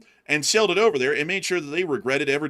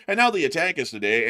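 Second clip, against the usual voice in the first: ordinary talk, nothing marked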